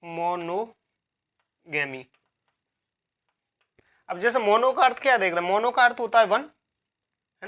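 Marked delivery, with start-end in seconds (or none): 0.0-2.0 monogamy drawn out very slowly in separate parts; monogamy broken up with a long pause before the lower, softer ending; slow dictation. Shown as a demonstration. Teacher writing out the word for students, slow dictation of monogamy.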